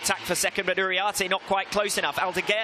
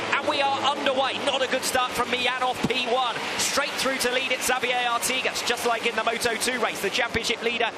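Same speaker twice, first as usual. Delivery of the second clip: excited race commentary; 5 semitones higher than usual. Acted. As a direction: call the race start with rising excitement. Race start play-by-play.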